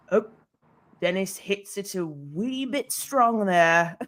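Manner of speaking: put-on slow, drawn-out Scottish golf commentator accent; thick Scottish accent. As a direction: imitate a Scottish TV commentator, slowly. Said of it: "he has a really thick Scottish accent".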